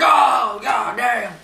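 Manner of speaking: high, loud, drawn-out yelp; mock pain shout. Boss whacked in the knee, hammed-up pain shout. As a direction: yelp like the crutch just hit.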